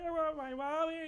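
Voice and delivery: high, loud imitated wail of a scared child; scared child in haunted house, mock wail; retold crying kid. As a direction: wail it like a terrified little kid. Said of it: "start crying and screaming".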